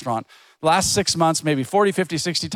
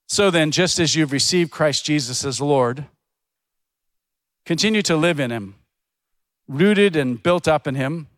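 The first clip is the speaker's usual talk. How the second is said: scripture reading; 2 semitones lower than usual. Acted. Reads Bible verse.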